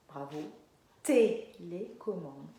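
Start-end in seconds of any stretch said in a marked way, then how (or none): 1.0-2.5 TÉLÉCOMMANDE slow, strong TÉ, then LÉ, COMMANDE apart; first syllable stressed. Shown as a demonstration. Teacher showing it starts the same, first syllable stressed. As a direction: hit the TÉ of TÉLÉCOMMANDE, then step through the rest. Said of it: "Ça commence pareil".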